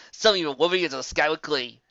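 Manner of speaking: ordinary delivery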